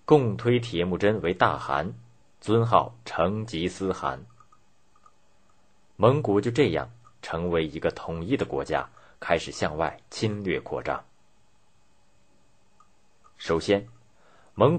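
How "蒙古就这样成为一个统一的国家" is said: ordinary delivery, nothing marked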